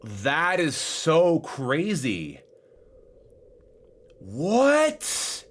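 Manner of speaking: high, loud, amazed outburst; excited yelling. Real, not acted. Streamer amazed that the chat trick works, yelling excitedly. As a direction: yell it out, dumbstruck with excitement. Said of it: "Sorry if I'm yelling".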